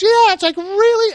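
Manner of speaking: put-on squeaky high kid asking really. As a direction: pitch up like a little kid. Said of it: "having the, the kid pitch his voice up".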